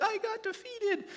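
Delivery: high-pitched mock whine; whiny. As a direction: whine it like a defeated sulker. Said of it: "he was whiny, and he's like".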